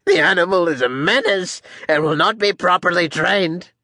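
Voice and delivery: high, insistent complaint; protesting captain. Acted. Captain blames the elephant, protesting.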